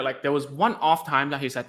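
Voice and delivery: ordinary delivery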